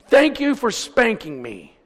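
high, slow, projected quote; emphatic repeat. Retold or imitated. Preacher repeating son's letter to the congregation, emphatic. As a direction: drive the line home, voice lifted.

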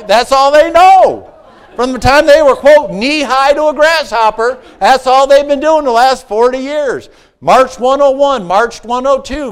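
loud, high, driving sermon delivery; preaching at full force. Acted.

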